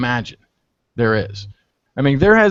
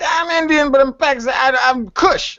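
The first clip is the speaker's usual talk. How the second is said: high, mock-indignant friend voice, higher pitch; hammed-up protest, pitched way up; imitating the friend; 10 semitones higher than usual. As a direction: friend insisting he's Kush, voiced high and put-on. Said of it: "So he's like".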